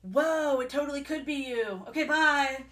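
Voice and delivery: mock breezy brush-off; airy, put-on casual parents; weirdly casual. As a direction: imitate someone oddly unbothered. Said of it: "weirdly casual about this".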